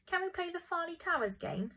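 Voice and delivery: put-on high, pleading child's request; high-pitched voiced plea; begging. A narrator's reading. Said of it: "begged Ambrose".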